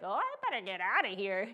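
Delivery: a swooping, mock-nervous "uh-oh"; mock alarm. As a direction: play up wanting to back away. Acted panicky reaction in story.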